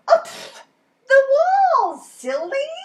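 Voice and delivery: high, loud scoff at silly question; scoffing Ted. A narrator's reading. Ted scoffing at the boy over what to paint on, high. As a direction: brush it off as obvious.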